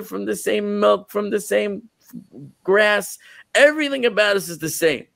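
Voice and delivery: weeping cow protesting to the prophet, high and hurt; pleading cow. Acted. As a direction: plead the injustice like a wronged child. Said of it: "the cow says to Eliyahu".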